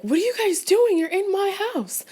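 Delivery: high, put-on voice of an outraged wife; mock-indignant hostess ordering guests out of her home, high-pitched. Wife confronting guests in her house.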